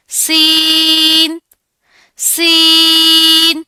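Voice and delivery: سین drawn out very slowly, high and loud; model letter seen. Shown as a demonstration. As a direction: stretch سین with a hiss. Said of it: "تو سین ادا ہوگا". (سین لمبی سیٹی کے ساتھ)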